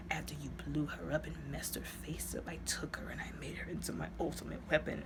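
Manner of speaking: hushed, menacing whisper of the villain. Retold or imitated. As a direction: breathe it like a sinister secret. Villain confessing in retold movie scene.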